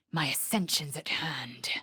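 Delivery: low whispered excitement; quiet awed whisper; hushed anticipation. Acted. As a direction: whisper it with awe.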